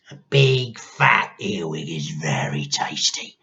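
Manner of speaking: hissed relish. A narrator's reading. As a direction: lean in and savour it. Grandma whisper.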